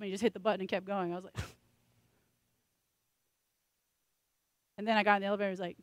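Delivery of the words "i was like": breathless whispered laugh; embarrassed giggle. Real, not acted. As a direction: laugh it out under your breath. Laughing recalling awkward moment.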